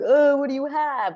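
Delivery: put-on high and loud, a nosy kid asking; curious kid voice. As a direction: play a curious schoolkid poking at your lunch. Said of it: "the kid being like".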